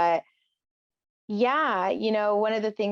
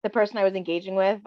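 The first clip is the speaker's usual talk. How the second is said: ordinary delivery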